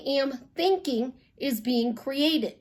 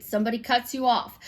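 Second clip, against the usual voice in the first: ordinary delivery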